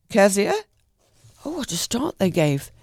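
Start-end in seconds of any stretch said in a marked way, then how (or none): 0.1-0.5 loud questioning call of a name; loud calling, rising on the end. Acted. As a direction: call her name, rising, across the yard. Aunt calls out from the door.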